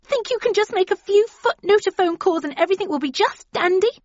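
high, loud, near-hysterical rant; furious high-pitched outburst; voice rising in anger. A narrator's reading. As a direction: shriek it with anger tipping into fear. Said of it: "anger and then fear as her voice rose".

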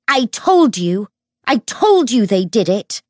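loud, high, vindicated outcry; crying out. A narrator's reading. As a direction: cry the accusation out to everyone. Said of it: "Tatiana cried".

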